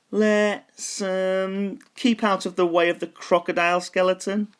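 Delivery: high, hesitant skeleton; a wary skeleton avoiding the crocodile; big skeleton voice. A narrator's reading. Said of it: "he said".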